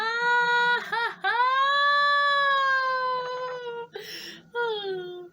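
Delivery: high, loud fangirl squeal; long excited squeal; long squealing laugh. Real, not acted. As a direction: shriek with delight.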